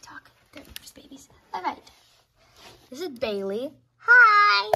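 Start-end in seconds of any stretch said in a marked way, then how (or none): 4.0-4.7 put-on very loud, high toddler greeting; put-on squealing tot. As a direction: shout hello as an excited two-year-old. Voicing two-year-old Bailey.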